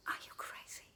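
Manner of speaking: breathless, voiceless disbelief; mother aghast at the beans; horrified whisper. Acted. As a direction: hiss it in shock.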